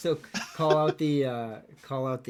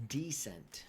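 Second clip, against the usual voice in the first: "Decent" whispered, unvoiced and soft; whispering; 2 semitones higher, 6 dB quieter than usual. Shown as a demonstration. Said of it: "you guys did decent".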